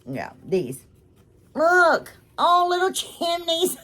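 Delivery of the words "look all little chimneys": delighted squeal. Real, not acted. Delighted by a gift.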